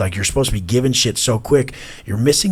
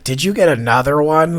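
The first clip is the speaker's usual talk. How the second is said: imitating the kid; 6 semitones higher, 5 dB louder than usual. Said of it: "She's like".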